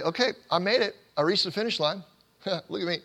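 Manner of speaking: smug high voice, laughing; playful smug bragging; mock boast. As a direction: brag like you crossed the finish line. Mock boast of finishing the race.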